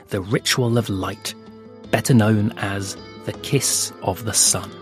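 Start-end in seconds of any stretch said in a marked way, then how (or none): none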